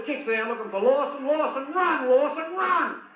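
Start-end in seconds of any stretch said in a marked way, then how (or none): 1.3-2.1 high urgent call, run; first call, already raised; calling out to a player. Real, not acted. Urging player from commentary box. 2.1-3.0 higher, more desperate cry of run; insistent shout at the player. Real, not acted. Urging player from commentary box.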